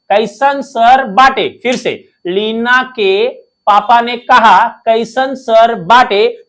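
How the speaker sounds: slow, drawn-out mnemonic line in Bhojpuri style; dialogue voice. Shown as a demonstration. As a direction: stretch each word like a dialogue. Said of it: "Leena के dialogue कैसा बोलना है". (धीरे-धीरे खींचकर बोला गया dialogue)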